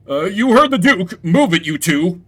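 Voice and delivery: guard ordering the prisoners to move; gruff guard. Acted. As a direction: hurry them along like a guard.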